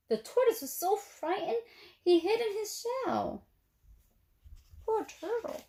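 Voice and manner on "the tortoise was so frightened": ordinary delivery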